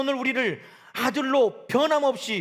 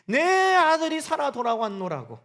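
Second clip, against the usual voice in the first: joyful father proclaiming; 5 semitones higher, 6 dB louder than usual. Pretend. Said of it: "사람들에게 말하기를".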